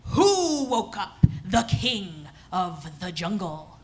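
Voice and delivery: slow, low lion voice; lion king. Acted. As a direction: boom it slowly like an offended king. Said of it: "And he said".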